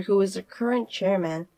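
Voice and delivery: usual speaking voice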